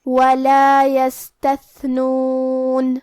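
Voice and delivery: slow melodic tajweed chant; Quran recitation. Acted. Teacher reciting the verse for students, slow tajweed chant.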